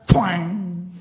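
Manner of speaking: voiced bowstring twang; twang sound effect. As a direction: mimic a bow twanging.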